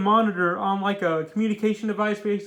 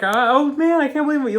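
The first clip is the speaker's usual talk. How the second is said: higher, louder acted-out disbelief; surprised Phil; 6 semitones higher, 7 dB louder than usual. Voicing the villain stunned at his own win, surprised. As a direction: exclaim it in amazed mock triumph. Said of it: "he's surprised".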